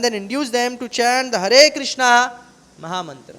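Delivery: slow, emphatic scripture reading; measured preacher reading to a congregation; formal reading aloud. Acted. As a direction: read it out loudly and deliberately, word by word. Reads scripture commentary aloud.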